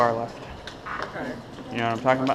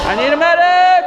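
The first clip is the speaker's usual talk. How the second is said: man down shout; 6 semitones higher, 4 dB louder than usual. Real, not acted. Hit in game, calling for medic.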